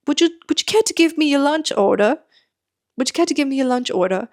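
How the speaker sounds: high, courteous mock British voice; attempted British accent. Staff asking for a lunch order, imitated British accent. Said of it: "if I can do the accent".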